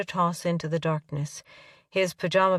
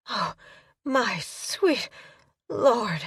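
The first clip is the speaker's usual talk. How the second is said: high, breathless gasped cry; breathless gasp at climax; 5 semitones higher, much slower than usual. A narrator's reading. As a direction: let it escape on a gasp. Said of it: "she gasped".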